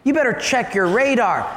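mock indignant denial; indignant driver. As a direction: argue back at the traffic cop. Driver argues with policeman in joke.

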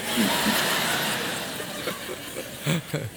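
breathless silent wheeze of laughter; wheezing laugh. Real, not acted. Preacher laughing at his dad's joke, wheezing. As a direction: laugh hard, barely voiced.

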